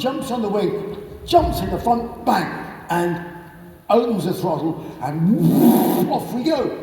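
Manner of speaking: normal talking voice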